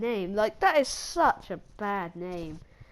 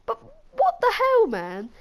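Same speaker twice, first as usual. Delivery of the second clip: outraged exclamation; 10 semitones higher, 6 dB louder than usual. Real, not acted. Reviewer outraged at Patrick.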